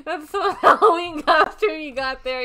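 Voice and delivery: loud, high words through laughter; laughing while talking. Real, not acted. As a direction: get it out between laughs. Cracking up at the costume glitch.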